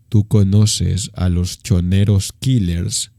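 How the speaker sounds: low, slow, menacing thug voice. Retold or imitated. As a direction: drop the voice low and drag it out like a threat. Gang member threatens him on bus. (pandillero amenazante, voz grave y lenta)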